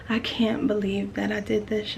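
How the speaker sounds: ordinary talk, nothing marked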